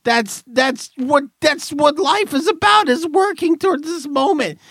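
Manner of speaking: high, strained mock outrage; mock rant. Real, not acted. Host ranting at retirees in high pretend outrage. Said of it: "I'm pretending".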